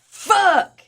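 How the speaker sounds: high, mock-wailing caller; high-pitched mock distress on the phone. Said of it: "Me calling that place".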